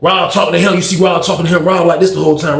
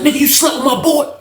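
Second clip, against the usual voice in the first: high-pitched accusation; arguing; 8 semitones higher than usual. Retold or imitated. Rob accusing his rival in a standoff, arguing. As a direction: imitate a heated back-and-forth.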